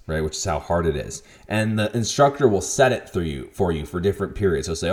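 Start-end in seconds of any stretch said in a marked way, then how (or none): none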